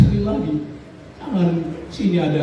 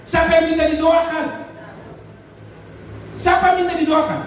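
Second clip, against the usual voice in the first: preacher's shout; 10 semitones higher, faster than usual. Real, not acted. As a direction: hurl the question at the congregation. Preacher challenging congregation.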